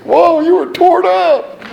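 high, slow, loud drawn-out whoa; imitating captain. Marine captain teasing the hungover co-pilot, hammed-up amazement. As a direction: play the amused Marine pilot.

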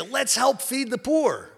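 high, slow keen chicken voice; the chicken proposing it, all enthusiasm; eager chicken. Acted. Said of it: "the chicken goes to the pig".

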